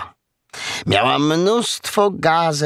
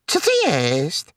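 higher-pitched, amazed question; astonished piglet; 5 semitones higher than usual. A narrator's reading. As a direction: ask it as an amazed little piglet. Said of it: "zdumiał się Prosiaczek".

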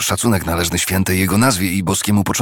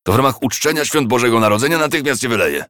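angry threat; 7 semitones higher, faster than usual. A narrator's reading. Scrooge threatening the clerk.

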